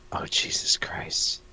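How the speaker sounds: breathless whisper of disbelief; whispered dread. Real, not acted. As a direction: whisper it in horror. Dreading what he must read.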